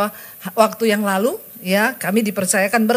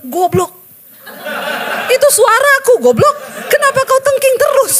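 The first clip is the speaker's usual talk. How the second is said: high, loud, laughing mock scolding; a booming mock rebuke, half laughing; God scolding; 11 semitones higher, 5 dB louder than usual. God mocks the preacher who keeps rebuking him, high and loud. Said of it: "Tuhan bilang".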